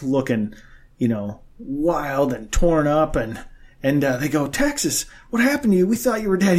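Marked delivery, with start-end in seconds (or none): 4.5-6.6 shocked locals. Retold or imitated. Bar guys shocked at Texan's return.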